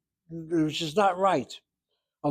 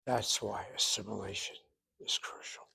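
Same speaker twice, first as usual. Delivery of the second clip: whisper; 6 semitones lower, 14 dB quieter than usual. Real, not acted. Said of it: "whispered lesson point".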